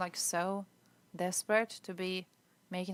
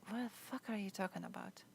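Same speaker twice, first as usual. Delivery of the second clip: said much softer, under the breath; muttered disbelief; 11 dB quieter than usual. Real, not acted. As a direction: mumble it to yourself in disbelief. Reacting to chat statement.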